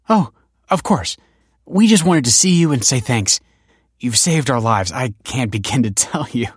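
overflowing thanks, half laughing; gushing gratitude to the director. A narrator's reading. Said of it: "gushed Sophia".

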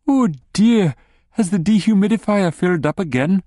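high, concerned wife voice; lightly voiced fussing wife. A narrator's reading.

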